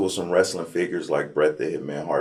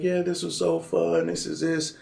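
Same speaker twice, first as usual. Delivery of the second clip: mock cheerful teammates; 9 semitones higher, 5 dB quieter than usual. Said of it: "And they're like".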